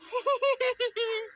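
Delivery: high, loud Joker cackle; shrieking high villain laughter; maniac clown laugh. Acted. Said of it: "what sounded like a maniac clown laughing".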